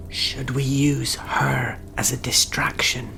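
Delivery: hushed whispered question. Acted. Sneaking toward the kitchen, asks partner in a whisper. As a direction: whisper it so the man in the kitchen can't hear. Stealth scene, whispered plotting.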